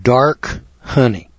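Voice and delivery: "dark" slow and underlined, pause before "honey"; stress on DARK. Shown as a demonstration. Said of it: "I'm gonna underline that word".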